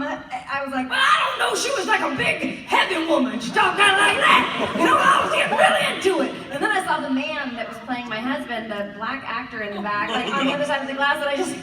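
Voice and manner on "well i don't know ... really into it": big, loud, high-pitched heavy-woman voice. Shown as a demonstration. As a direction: belt it out as a larger-than-life mom.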